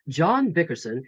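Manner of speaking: normal talking voice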